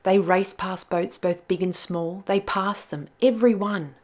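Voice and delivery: put-on lively read-aloud for children; story narration. A narrator's reading. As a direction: tell it like a bedtime tale.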